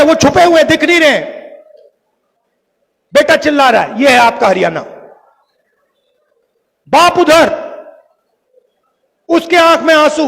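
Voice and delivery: ordinary talk, nothing marked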